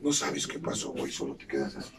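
low, subdued and hushed; quiet low musing. Real, not acted. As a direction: drop the voice low and quiet, almost to yourself. Relato de despertar, voz baja. (voz baja y apagada)

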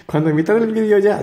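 can't help laughing while retelling. Real, not acted. Vlogger retelling silly example solves, laughing as he speaks.

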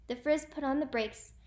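usual speaking voice

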